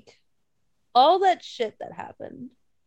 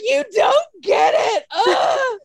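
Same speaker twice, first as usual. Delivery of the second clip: hammed-up wailing protest, slow and loud; dramatic drunk bickering; 11 semitones higher, 6 dB louder, much slower than usual. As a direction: imitate a drunken meltdown, high, loud and drawn out. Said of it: "I guess it sounded really dramatic".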